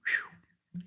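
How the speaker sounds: breathless unvoiced whew after singing; quiet whew after the hymn; whispered whew. Real, not acted. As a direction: let out a soft breathy whew.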